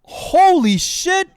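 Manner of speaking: high, loud, drawn-out slow Holy shit; real stunned outburst; excited shout. Real, not acted. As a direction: yell in disbelief at the loot. Reacting to a great pull.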